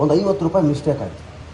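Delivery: usual speaking voice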